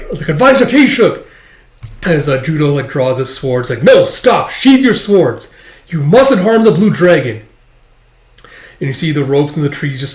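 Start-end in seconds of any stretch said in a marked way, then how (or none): 0.3-1.3 high, loud cry of a name; alarmed shout. Acted. Soldier calls to advisor attacked by dragon, alarmed shout. 3.8-7.4 high, loud order to halt; shouted command. Acted. Ordering soldiers not to harm the dragon, shouted.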